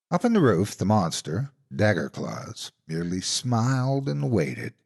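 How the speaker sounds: ordinary delivery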